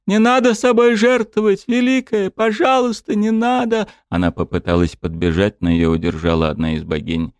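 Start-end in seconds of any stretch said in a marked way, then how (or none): 0.1-3.9 tearful scream. A narrator's reading. Weeping dryad screaming as her elder sacrifices herself. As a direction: cry out in despair. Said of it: "кричала вся в слезах Элина".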